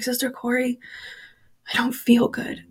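softly voiced unwell little boy; understated, quiet little complaint; retold weak child. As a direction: murmur it softly, imitating a poorly child. Sick child whispers to leader.

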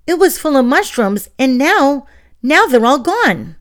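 high, loud, rising distress; little witch panicking over vanished mushrooms; upset. Acted. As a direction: pour out the alarm, voice climbing. Distressed witch at empty basket.

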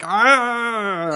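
high drawn-out "aah" of mock pain; long, high imitation groan of pain; mock pained cry. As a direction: imitate a yelp from a stinging slap. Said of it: "and he goes like".

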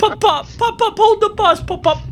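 very high calls to hold the bus; high frantic calling. Retold or imitated. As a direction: call out high to stop the bus. Plays a kid calling to grandpa.

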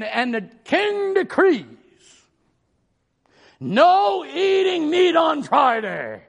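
proclaiming like a town crier; loud slow royal proclamation. Retold or imitated. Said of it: "a guy called the town crier".

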